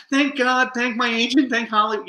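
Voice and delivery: mock-gushing, very high-pitched thanks; gushing award winner. Award winner thanking everyone on stage, mock gushing.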